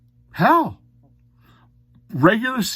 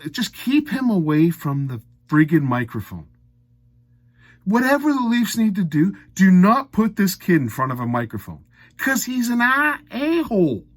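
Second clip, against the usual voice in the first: much higher, heated and pushed; exasperated rant; 7 semitones higher than usual. Real, not acted. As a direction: blow up at the team's PR. Ranting against the player.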